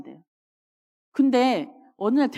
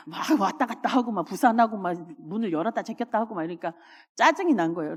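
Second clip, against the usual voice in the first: usual speaking voice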